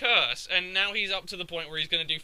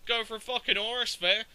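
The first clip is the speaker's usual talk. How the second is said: ordinary delivery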